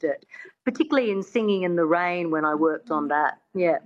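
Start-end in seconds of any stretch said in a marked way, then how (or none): none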